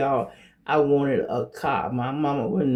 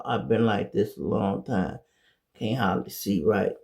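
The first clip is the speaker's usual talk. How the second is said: normal talking voice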